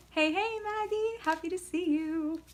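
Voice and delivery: high, bright sing-song hello to a chatter; excited greeting. Real, not acted. Greeting a viewer in live chat, high sing-song hello.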